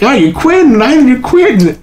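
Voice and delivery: high, sing-song teasing; mocking bandmate. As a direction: needle him about quitting.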